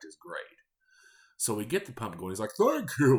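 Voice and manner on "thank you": high, loud put-on "thank you"; old man voice. Customer thanking the cashier in his strange voice. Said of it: "they had the exact same voice".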